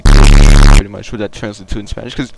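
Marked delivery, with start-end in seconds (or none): none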